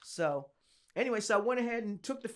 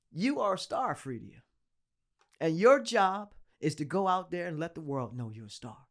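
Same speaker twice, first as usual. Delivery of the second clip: measured, falling voice with long pauses; hushed, weighty grandmotherly advice; imitated solemn grandmother; 4 semitones lower than usual.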